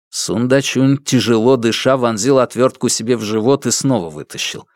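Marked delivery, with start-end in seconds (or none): none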